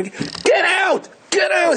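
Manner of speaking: high, barked command, repeated; angry order. Retold or imitated. Innkeeper ordering the poor Yid out, high and angry. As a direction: throw him out with a high bark.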